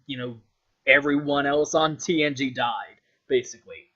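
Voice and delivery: ordinary talk, nothing marked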